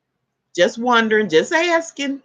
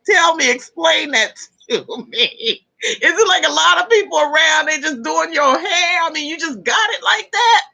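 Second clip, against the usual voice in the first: laughing; 6 semitones higher than usual. Real, not acted. Laughing while questioning a viewer.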